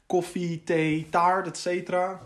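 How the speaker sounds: usual speaking voice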